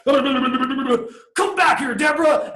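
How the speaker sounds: high, slow laughing yell; laughing shout. Acted. Teacher playing a cartoon victim chasing Deborah, laughing shout. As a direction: bellow it after her, laughing like a cartoon. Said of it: "and I'm like".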